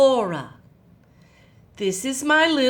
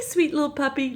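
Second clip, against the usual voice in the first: ordinary delivery, nothing marked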